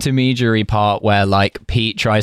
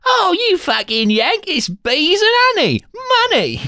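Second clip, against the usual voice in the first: very high, exasperated mock Cockney; hammed-up fake Cockney squawk; bad Cockney accent. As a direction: overdo a terrible Cockney, stretching the words. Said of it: "cannot do a Cockney accent".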